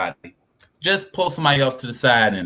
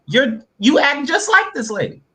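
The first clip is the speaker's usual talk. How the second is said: mock callout; 11 semitones higher than usual. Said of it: "it's like".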